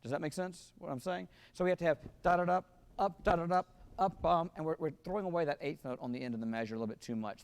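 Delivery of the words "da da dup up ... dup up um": loud "da-da-dup" with a lifted "up" before each downbeat; spring off "up" and land strongly on "da"; jumping pickup. Shown as a demonstration. Director showing how to jump into the downbeat, accented pickup. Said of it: "we have to jump up in the air".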